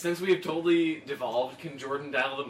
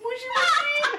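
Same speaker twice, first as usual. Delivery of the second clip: much higher, mock Japanese phone greeting. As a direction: squeak a jokey moshi moshi down the line. Pretending to answer a phone.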